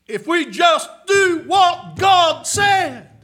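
high, loud preaching build; preaching shout. Acted. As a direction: call it out over the congregation.

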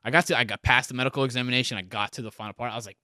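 ordinary delivery

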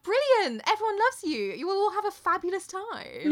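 high, loud, over-the-top enthusiasm; gushing. Retold or imitated. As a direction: fawn over him with fake delight. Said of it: "Davy's like".

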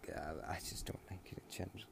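breathy unvoiced whisper. Real, not acted. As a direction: trail off into a whisper. Tired aside.

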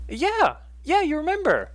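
very high, loud, excited woman's voice; Martine. Acted. Martine to Essie who remembers her, high excited character voice. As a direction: pitch it right up, thrilled to be recognised. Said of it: "It's Martine".

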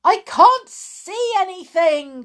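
high, loud exclamation; alarmed cry. A narrator's reading. Porter with foam in his eyes, crying out. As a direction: cry out in blind confusion. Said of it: "cried the porter".

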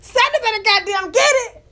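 hyping Sally. Retold or imitated. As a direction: cheer her like a fan at the TV. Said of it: "I said".

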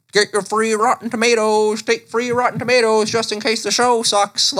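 high-pitched tomato hawker's call; street vendor. Acted. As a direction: hawk free goods to the passing crowd. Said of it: "maybe there was a dude out front".

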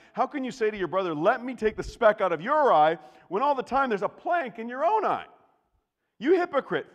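high, loud, fast rebuke; fiery preaching; preaching at full force. Acted. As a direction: rebuke the hypocrite.

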